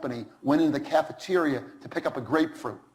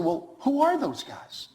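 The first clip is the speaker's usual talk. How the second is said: mock innocent CEO; 10 semitones higher, slower than usual. CEO asks innocently, retold.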